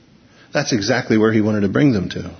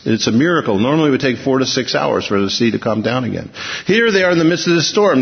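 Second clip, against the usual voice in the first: ordinary talk, nothing marked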